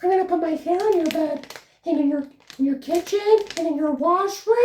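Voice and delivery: high, fast, eerie witch voice; cackling threat from a hag who leaves hair everywhere; creepy hair-pulling witch. Acted. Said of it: "some strange woman who pulls her fucking hair out like the fucking witch".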